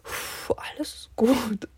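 breathy whispered self-calming. Retold or imitated. Student whispering to herself to stay calm after nearly crying.

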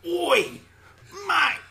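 Aussie ad-lib. Pretend. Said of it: "Australian ad-libs?".

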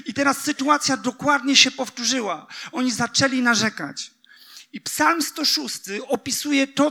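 high, loud, driving sermon voice; fervent sermon delivery; preaching at full force. Acted. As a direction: push the point home to the congregation. Preacher climbs to climax.

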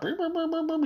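high beeping phone alert; imitated phone beeps. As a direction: beep like a phone notification.

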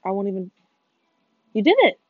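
ordinary delivery

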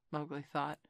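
ordinary delivery

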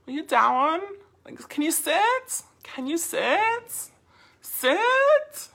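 put-on very high, drawn-out valley girl questions; exaggerated valley girl uptalk. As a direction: stretch and lift each ending, valley girl style. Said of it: "It's my valley girl".

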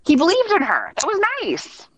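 high-pitched, emotional and halting; moved, with pauses to collect yourself; touched. Real, not acted. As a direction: voice lifting high as the feeling hits. Moved recalling tearful goodbye, pauses.

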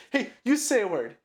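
hammed-up irritation; pissed-off character. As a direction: act out an annoyed dare. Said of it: "characters get pissed off".

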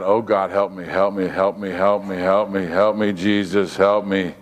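low, repetitive pleading chant; urgent inward prayer. Retold or imitated. Preacher retelling his silent plea to God, low urgent prayer. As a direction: keep pleading under your voice, pitched low.

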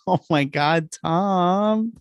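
high-pitched mock-appalled exclamation; mock dismay. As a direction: react in high, hammed-up disbelief. Mock reaction to Brady's bloody lip.

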